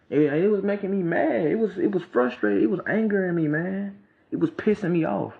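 high-pitched, frustrated venting; pissed off. Real, not acted. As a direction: let the remembered anger push your voice up. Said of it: "you can hear that in my voice".

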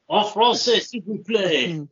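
a lightly put-on French teacher; prim teacher correcting in French; mock French. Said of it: "mimicking a French teacher".

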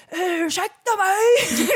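very high, drawn-out holler; hammed-up loud screech; shouted mock outrage. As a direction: yell it slowly like an indignant neighbour. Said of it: "Går ut och skriker".

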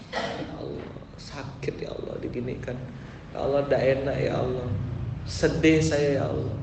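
mock lament prayer. Preacher voicing a wronged person complaining to God, soft then rising plea. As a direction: act out a hurt believer crying to God. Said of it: "misalnya gitu ya".

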